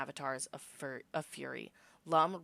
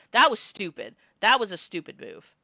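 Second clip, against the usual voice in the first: self-scolding; 5 semitones higher, 13 dB louder than usual. Real, not acted. Reader comments on own choice.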